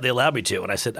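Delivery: usual speaking voice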